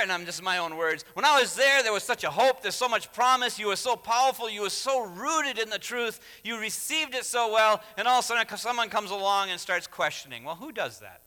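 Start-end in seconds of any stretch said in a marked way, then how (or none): 1.1-10.4 loud, high, impassioned appeal; Paul incredulous. Acted. Preacher imagines Paul addressing the Galatians, loud and incredulous. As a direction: pile up the praise in an exasperated raised voice. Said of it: "I can imagine he's saying".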